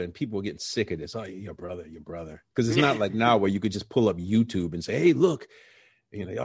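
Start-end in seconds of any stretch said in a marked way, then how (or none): 1.1-2.4 mock bored. People mocking his bragging. 5.0-5.5 eager. Retold or imitated. Imagined showing a video.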